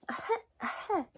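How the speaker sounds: tiny high cough; flea's little cough. A narrator's reading. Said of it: "No one heard him cough".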